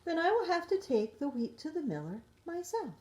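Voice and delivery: little hen sighs she'll do it herself; determined hen. A narrator's reading. Hen tells lazy friends she'll go alone, in high firm voice. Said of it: "hen resolves to go alone".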